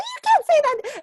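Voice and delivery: high, loud indignant shout; outraged high yell "You can't say that"; mother-in-law outraged. Retold or imitated. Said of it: "she's like".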